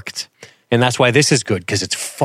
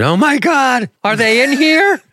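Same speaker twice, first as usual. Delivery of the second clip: very high-pitched frightened squeal; mock panic; 4 dB louder than usual. Reacting to talk of a brown recluse spider, mock panic. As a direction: panic in a hammed-up falsetto.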